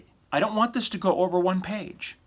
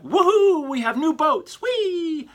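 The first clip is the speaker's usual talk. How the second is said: much higher and louder, gushing like a cheesy ad; mock advertising hype; 10 semitones higher, 4 dB louder than usual. Lecturer mocks a gushy ad-style news release, hammed-up glee. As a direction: ham up a giddy sales pitch. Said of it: "It cannot sound like".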